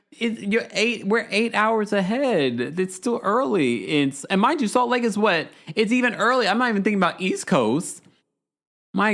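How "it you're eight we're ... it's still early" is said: high, slowed-down mimicry; mock Heather. Recapper mocking Heather's time-zone line, high and slow. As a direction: stretch it out like a teasing echo. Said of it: "She said".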